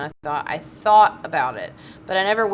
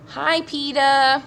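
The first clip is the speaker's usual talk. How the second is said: put-on high, slow little boy on the phone; higher childish voice, slow and drawn out; 7 semitones higher, 5 dB louder, much slower than usual. A narrator's reading. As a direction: draw it out like a small child.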